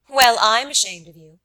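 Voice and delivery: loud, frank scolding; frank rebuke. Acted. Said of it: "said Miss Ivors frankly".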